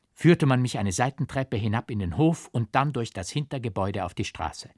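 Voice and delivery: ordinary delivery, nothing marked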